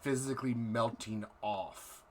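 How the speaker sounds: dramatic scene-setting narration; game master narration. Acted. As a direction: set the dark scene slowly.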